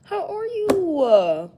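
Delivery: high, slow sweet greeting; imitating her again. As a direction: coo it like a sweet lady. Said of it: "She like".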